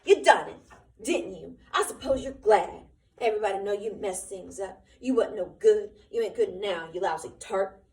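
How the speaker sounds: loud, bitter yelling; vicious outburst. Acted. Candy cursing Curly's dead wife, vicious and loud. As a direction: spit the blame at her. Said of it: "he said viciously".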